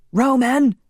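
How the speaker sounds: high, loud urgent shout. A narrator's reading. Laszlo shouting at Roman to act, urgent. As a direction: shout to snap him out of it. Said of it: "Laszlo shouted".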